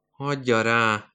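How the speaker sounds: usual speaking voice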